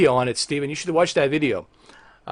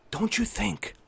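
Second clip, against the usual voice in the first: very soft, tightly held question; hushed restraint; 6 semitones higher, 9 dB quieter than usual. Acted. Streamer holding back anger at Xbox boss, hushed restraint. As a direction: ask it under your breath. Hushed, before exploding on same words.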